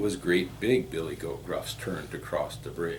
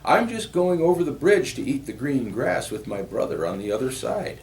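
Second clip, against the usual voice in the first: higher, louder billy goat; raised voice, innocently explaining; middle goat voice; 7 semitones higher, 5 dB louder than usual. A narrator's reading. Middle goat explaining to the troll, higher goat voice.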